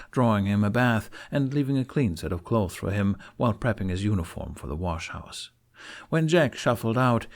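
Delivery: usual speaking voice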